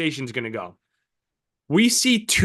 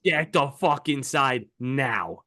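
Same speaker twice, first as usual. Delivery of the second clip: slow, weighted command, word by word; firm slow order; 3 semitones lower, slower than usual. Acted. Telling the boys to get inside, slow and firm. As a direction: order everyone in, stretching each word.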